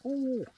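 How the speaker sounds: high, loud "ooh"; a sudden high-pitched ooh of surprise; surprised ooh. Real, not acted. Reacting to something mid-sentence.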